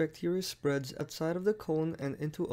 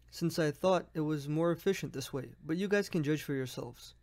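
normal talking voice

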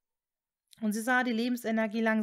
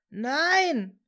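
long, loud anguished cry; desperate scream; 5 semitones higher, 7 dB louder than usual. A narrator's reading. Severus sees Hermine buried, desperate drawn-out scream. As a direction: wail it out, drawn-out and raised. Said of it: "schrie Severus verzweifelt".

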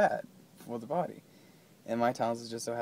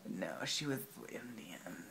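whisper; 10 dB quieter than usual. Real, not acted. Whispered aside.

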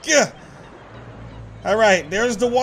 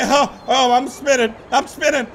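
ordinary talk, nothing marked

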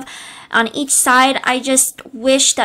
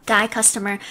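ordinary delivery

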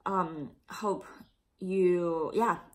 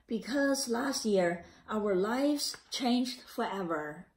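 put-on a gentle read-aloud for children; story narration; 4 semitones higher than usual. A narrator's reading. Teacher reading the class a picture book, gentle narration.

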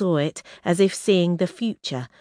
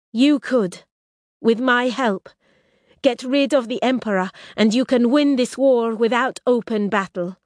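raised, urgent bargaining princess voice; Risha, proud princess; 7 semitones higher, 4 dB louder than usual. Acted. Exiled princess tempting her enemies, raised and urgent. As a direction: press the offer, pitched high.

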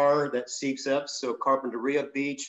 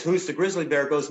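plain reading voice

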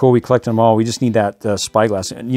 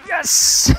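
high-pitched, thrilled yes; excited. Real, not acted. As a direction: celebrate the find with a squeal. Finds the red sheep.

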